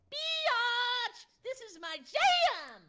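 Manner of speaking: very high, loud scream; screaming Eli line. Shown as a demonstration. Voice actor screaming his character's line for a fan. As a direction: belt it out in a high squeal. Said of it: "Where I'm, like, screaming".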